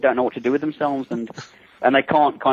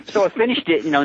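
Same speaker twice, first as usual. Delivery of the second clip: ordinary talk, nothing marked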